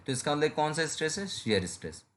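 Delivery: ordinary talk, nothing marked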